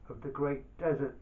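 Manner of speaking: plain reading voice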